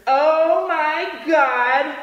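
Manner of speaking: high, loud, drawn-out mock horror; slow wail of shock, imitating her; horrified girlfriend. Girlfriend catches him, horrified.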